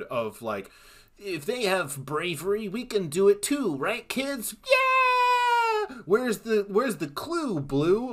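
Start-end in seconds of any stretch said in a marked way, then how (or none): 1.2-4.5 put-on high, sugary children's TV host; mock a cheesy kids' show presenter. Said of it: "felt like a Nickelodeon episode". 4.6-6.0 very high, loud cartoon cheer; kids cheering. Mock kids cheering on show. 6.1-8.1 put-on high, sugary children's TV host; mock a cheesy kids' show presenter. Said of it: "felt like a Nickelodeon episode".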